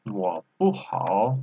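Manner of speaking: slow 我不好 with 我 kept low; half third tone. Shown as a demonstration. Teacher demonstrating the sentence, 我 in half third tone. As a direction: hold 我 low without a rise before 不好. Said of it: "it's half third tone".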